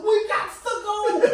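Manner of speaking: high, drawn-out mock Southern accent; hammed-up Southern plea. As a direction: urge slowly in an imitated Southern accent. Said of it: "this southern accent".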